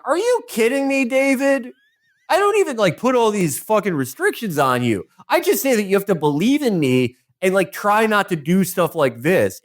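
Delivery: high-pitched, incredulous scolding; exasperated God. Retold or imitated. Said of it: "and God is like".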